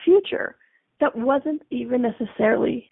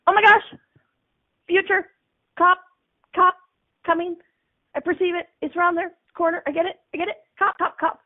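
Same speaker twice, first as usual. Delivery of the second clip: pitched up, rapid-fire sense of danger ahead; excited alarm; 4 semitones higher than usual. Retold or imitated. Driver sensing cops ahead, excited and alarmed. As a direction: blurt it out like you just spotted the cops. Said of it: "and you're like".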